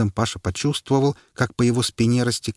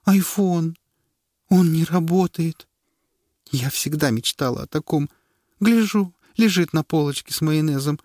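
high, tearful lament; tearful whining; 9 semitones higher than usual. Acted. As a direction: whimper it like a crushed child. Wife weeping over broken iPhone. (жена плачет над айфоном)

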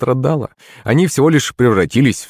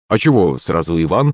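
lower-pitched grumbling; defensive mumble; 7 semitones lower than usual. A narrator's reading. Father singled out by his wife, mumbling defensively. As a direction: mumble a mild protest. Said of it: "забормотал батя".

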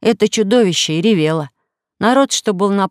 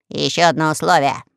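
parrot shouting; 3 semitones lower than usual. Acted. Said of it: "крикнул попугай".

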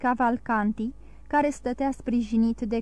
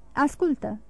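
ordinary delivery, nothing marked

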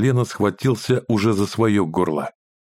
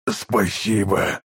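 slow, hoarse wheeze; hoarse rasp; much slower than usual. A narrator's reading. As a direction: croak it out hoarsely. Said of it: "прохрипел он".

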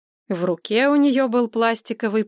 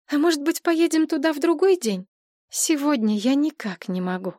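high pleading suggestion; wheedling; 6 semitones higher than usual. Acted. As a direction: coax her to postpone. Мария уговаривает отложить. (упрашивая)

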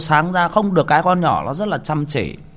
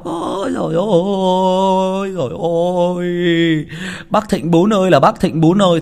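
mock wailing; 5 semitones higher than usual. Imitating the wailing family at the funeral. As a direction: ham up a mourning relative's cry.

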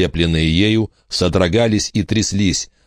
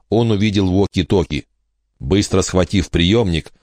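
usual speaking voice

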